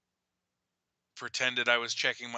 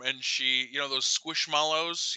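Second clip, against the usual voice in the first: ordinary talk, nothing marked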